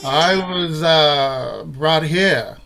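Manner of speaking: film noir voice. Acted. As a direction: drop low and slow, hard-boiled. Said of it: "ready for the film noir version".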